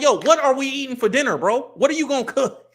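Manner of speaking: high, loud acted demand for a straight answer; exasperated questioning. Fed-up questioner asking a candidate about dinner, exasperated and high.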